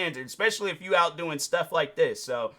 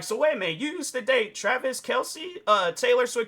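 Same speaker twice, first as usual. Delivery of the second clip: high, rising what-wait-a-minute question; gossipy fan realizing who she dated; mock surprise; 8 semitones higher than usual. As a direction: act like a stranger just figuring it out. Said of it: "people gonna be all like".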